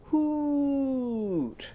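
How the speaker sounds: long, high hoot sliding down at the end; owl hoot. Shown as a demonstration. Teacher showing children what the owl goes, long high hoot. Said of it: "what does he go?".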